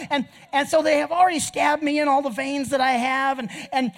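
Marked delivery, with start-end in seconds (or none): none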